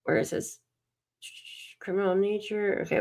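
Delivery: ordinary talk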